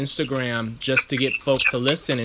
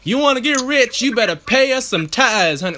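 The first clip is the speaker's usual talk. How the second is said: loud, high-pitched preacher demanding money; mock TV evangelist working the congregation; hammed-up religious-show pitch, voice raised high; televangelist impression; 9 semitones higher, 5 dB louder than usual. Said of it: "like, televangelism".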